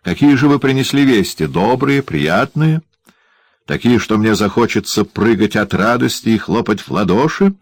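a spiteful dwarf feigning delight; mock-interested dwarf. A narrator's reading. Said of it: "заинтересовался карлик". (язвительный карлик с притворным интересом)

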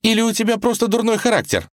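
put-on high, fast girl's retort; needling accusation in a high voice; irritated. Girl quarrels with Ivan over his character, irritated.

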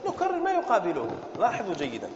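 ordinary talk, nothing marked

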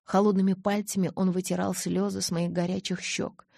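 usual speaking voice

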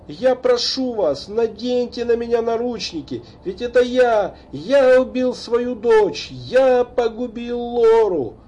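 anguished confession. A narrator's reading. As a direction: confess in despair. Father confesses killing daughter. (надрывное признание)

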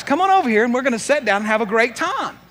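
rally reluctant listeners to join in; hammed-up eager inviting; eager invitation. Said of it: "I'd be inviting them".

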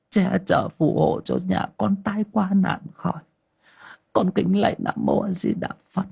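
put-on high, chanted old-woman prayer; high-pitched devotional incantation; murmured prayer. A narrator's reading. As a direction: pray to heaven and Buddha in a high, pleading chant. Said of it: "chắp tay miệng lẩm rẩm khấn vái".

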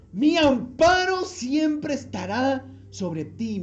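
slow, high, loud declaration; slow soaring vow; fervent promise. Real, not acted. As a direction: stretch out the promise.

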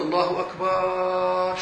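slow, stretched أكبر with a dragged vowel; over-long الله أكبر; mock drawn-out takbir. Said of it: "يقولون".

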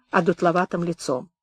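ordinary delivery, nothing marked

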